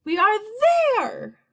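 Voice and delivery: high, loud rise on "we are"; trying to be cheerful. A narrator's reading. Grasshopper cheering up his friends, forced cheer. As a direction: announce it brightly, dropping on the last word. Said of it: "trying to be cheerful".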